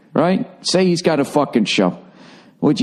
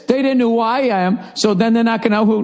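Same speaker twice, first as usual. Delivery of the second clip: ordinary talk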